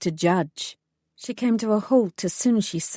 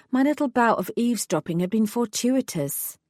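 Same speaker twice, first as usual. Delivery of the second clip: ordinary delivery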